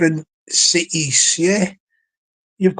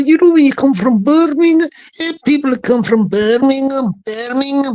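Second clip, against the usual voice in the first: higher-pitched put-on Brummie accent; Birmingham accent; 6 semitones higher, 4 dB louder than usual. Shown as a demonstration. Man trying to get that voice right, Birmingham accent. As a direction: play it as a broad Brummie. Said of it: "you got to get that voice right".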